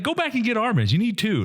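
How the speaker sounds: high, indignant rebuke; a retold outraged callout to a player; mock outraged rebuke. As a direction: call him out, incredulous. Retelling angry rebuke.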